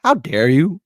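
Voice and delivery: loud indignant high burst; mock flare of indignation; how dare you, reliving the insult; retold outrage. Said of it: "And I'm like".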